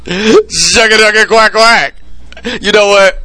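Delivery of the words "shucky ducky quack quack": catchphrase. Retold or imitated. Quoting the catchphrase.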